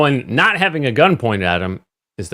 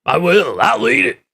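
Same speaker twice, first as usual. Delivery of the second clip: defiant rant rising in pitch; Alex Jones. Acted. Doing a radio ranter's line, worked-up impression. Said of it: "You do a pretty good Alex Jones".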